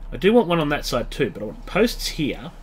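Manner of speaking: normal talking voice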